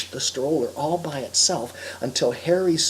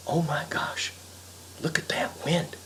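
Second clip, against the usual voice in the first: hushed dawning worry; 8 dB quieter than usual. Acted. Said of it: "he thought".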